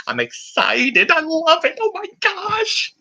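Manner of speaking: high, slow, gushing movie-character voice; Tommy Boy impression. Fantasy league recap, doing Tommy Boy, squealing high and slow. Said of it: "That's my Tommy Boy impersonation".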